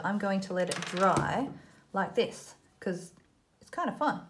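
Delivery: ordinary talk, nothing marked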